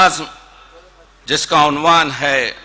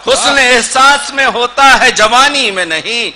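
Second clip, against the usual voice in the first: raised, ringing mushaira declamation; Urdu poetry recitation; 12 semitones higher, 8 dB louder than usual. Acted. Poet repeats verse after the audience's praise, declaimed. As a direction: project each phrase of verse to the hall.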